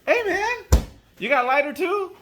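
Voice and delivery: high, loud mock wheedle; pestering drunk guy. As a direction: imitate a guy calling out for a favour. Same guy pestering for a lighter.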